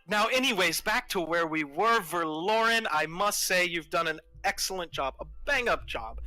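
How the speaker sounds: lavish sarcastic praise on the rebel leader; gleefully condescending villain. Acted.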